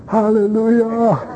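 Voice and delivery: slow, beaming Hallelujah; joyful hallelujah. Retold or imitated. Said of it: "What am I gonna say?".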